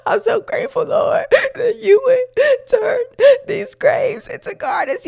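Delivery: very high, squeaky mock sobbing; imitated grateful weeping. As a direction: act out crying a thankful prayer, voice way up high. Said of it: "I'm crying and I'm weeping".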